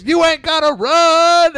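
high, loud, slow bouncer voice; high mock bouncer. Bouncer to fleeing comic, high loud call. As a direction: holler like the bouncer. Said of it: "The bouncer goes".

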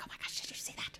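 urgent mock whisper to a partner; acted scandalised whisper. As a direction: hiss it secretly under the breath. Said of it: "they're kinda whispering".

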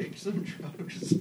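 slow, whispered trippy chant. Pretend. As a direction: breathe it out slowly. Spooky trippy parody.

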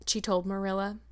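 ordinary delivery, nothing marked

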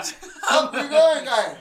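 put-on loud, high Res accent holler; Res accent shout. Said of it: "harshest fucking Res accent".

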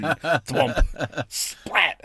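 high, loud launch-and-splash noises; imitated thwomp and splat. Narrator mimicking water balloon launches for his friend. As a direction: make the slingshot sounds with a laugh. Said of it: "a thwomp sound ... the splat".

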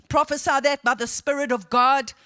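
ordinary talk

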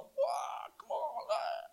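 unvoiced whispered taunt; hushed mock challenge; whispered dare. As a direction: whisper the bravado. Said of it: "Next minute I'm like".

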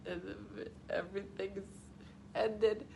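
soft, halting, choked-up retelling; hammed-up weepy fragments; mock hysterical. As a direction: falter through it as if overcome. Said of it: "I was hysterical during E T".

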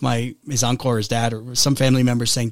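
normal talking voice

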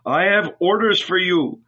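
high, official-sounding call; cried command. A narrator's reading. Centurion at the gate, calling out orders. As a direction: announce it as an order. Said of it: "he cried".

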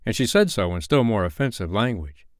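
ordinary delivery

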